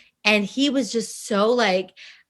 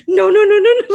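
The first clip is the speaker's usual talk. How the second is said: high, loud giggly protest; loud high playful protest; excited no; 10 semitones higher, 8 dB louder than usual. Retold or imitated. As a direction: squeal no with too much excitement. Excited refusal acted in retelling.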